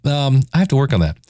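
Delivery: ordinary talk, nothing marked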